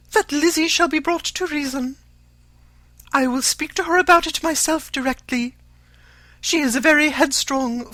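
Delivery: high, loud, fussy matron; shrill-free but high and insistent mother; Mrs Bennet's voice. A narrator's reading. To Mr Collins, an eager high mother's voice.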